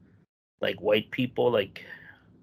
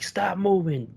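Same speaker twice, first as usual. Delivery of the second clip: higher-pitched imitation of a yelled order; mock yell, urgent commands, pitched up; retold yelling; 6 semitones higher, 4 dB louder than usual. Amped-up cop ordering a driver out, mock yelling. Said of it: "he's, like, yelling".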